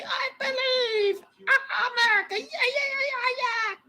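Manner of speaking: high, strained mock cheer; cheering American. Streamer mocks American fans' belief, high strained mock cheer. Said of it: "I can never say".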